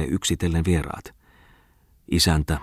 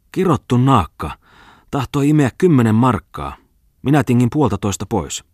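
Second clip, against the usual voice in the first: higher, louder indignant rant; angry rant; 7 semitones higher, 5 dB louder than usual. Acted. Tommola complaining to the gentlemen, angry rant. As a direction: spit out the grievance. Tommola rants about the girl.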